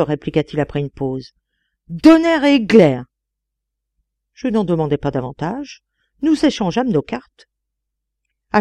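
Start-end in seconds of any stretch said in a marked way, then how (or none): 1.9-3.0 loud, high, slow curse; outraged oath. A narrator's reading. Insulted elector answering the narrator, outraged slow oath. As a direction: explode word by word.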